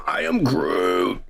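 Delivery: low, slow Groot growl; I am Groot, deep and drawn out. Retold or imitated. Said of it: "he starts going".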